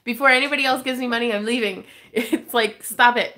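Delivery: loud, fast, laughing protest; flustered excited. Real, not acted. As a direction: blurt it out, laughing and overwhelmed. Flustered by donations.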